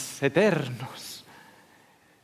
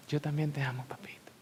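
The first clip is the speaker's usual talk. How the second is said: whisper; 9 dB quieter than usual. Retold or imitated. Child whispering love to God.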